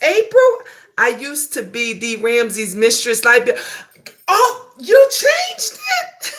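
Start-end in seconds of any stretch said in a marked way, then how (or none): 4.3-6.3 high, drawn-out surprised cry; a delighted squeal of surprise. Real, not acted. Reading chat, light bill news.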